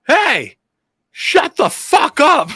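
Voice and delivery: high, full-voiced shout with drawn-out words; imitated angry yell. Scooter rider to the man screaming outside, angry yell. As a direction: yell it across the street at a nuisance. Retelling a passerby yelling at screamer.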